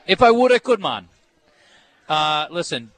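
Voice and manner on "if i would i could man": high, louder hammed-up Jamaican accent; a goofy Jamaican shrug; mock Jamaican accent. Host answering about dreadlocks in mock Jamaican accent. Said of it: "your Jamaican accent".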